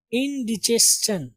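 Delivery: इंडिजेशन slowly, in-di-JES-chan; correct pronunciation. Shown as a demonstration. Teacher introducing the word, slow in-di-jes-chan. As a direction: stretch इंडिजेशन, ending in chan. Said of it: "यहाँ पे चा की आवाज आएगी".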